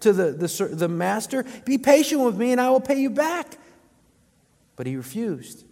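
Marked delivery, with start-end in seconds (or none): 1.7-3.6 begging. A narrator's reading. Begging servant in parable.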